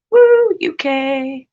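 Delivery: high, drawn-out whoop; cheering. Real, not acted. Cheering for the UK, a loud whoop. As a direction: give a loud, slow cheer.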